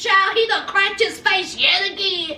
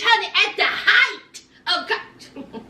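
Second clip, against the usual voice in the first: normal talking voice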